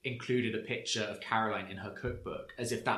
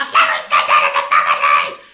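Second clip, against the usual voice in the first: much higher, much louder, fast shouted denial; mock frantic denial; 18 dB louder, faster than usual. Mimicking Teresa denying a magazine quote in a hammed-up shout.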